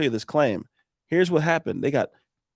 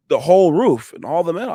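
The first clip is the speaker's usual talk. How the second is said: mock boast, louder and higher; imitated pride, punched out loudly; proud homeowner; 5 semitones higher, 7 dB louder than usual. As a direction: announce it like a pleased homeowner. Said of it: "he's so proud".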